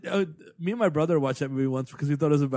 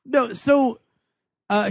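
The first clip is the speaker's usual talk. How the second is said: usual speaking voice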